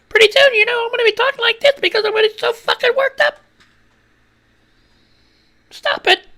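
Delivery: squeaky high worked-up voice; high-pitched excited squeal; voice going up. Shown as a demonstration. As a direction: crank the pitch way up, fast and wound up. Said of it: "my voice is going up".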